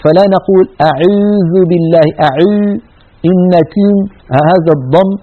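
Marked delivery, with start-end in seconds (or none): 0.9-2.1 أعوذ slow with the waw mixed with ya; fronted waw. Shown as a demonstration. Teacher imitating a waw mixed with ya. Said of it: "واو مخلوطة بياء".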